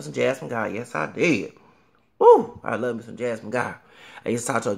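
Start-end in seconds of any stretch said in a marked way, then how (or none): 2.2-2.6 high, loud whoop; delighted woo shout; excited woo. Real, not acted. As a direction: cheer like a thrilled fan. Excited fan cheer.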